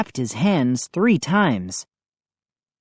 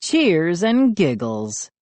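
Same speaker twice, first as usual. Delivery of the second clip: plain reading voice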